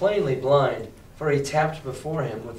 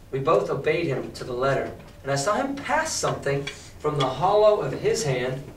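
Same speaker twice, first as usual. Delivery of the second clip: plain reading voice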